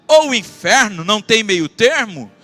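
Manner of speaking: loud, driving sermon voice; full-force preaching. Acted. Pastor urging his congregation in a loud preaching voice.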